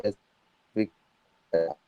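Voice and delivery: ordinary talk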